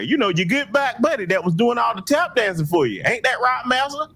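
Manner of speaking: high-pitched, pleading parody of a fawning loyalist; mock servile sidekick. Sellout begs the master to spare him, mock servile. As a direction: plead up high like an eager lackey. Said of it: "play the role of the good little Negro".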